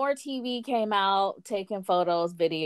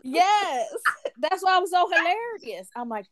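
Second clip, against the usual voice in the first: ordinary delivery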